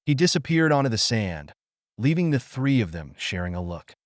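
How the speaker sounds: plain reading voice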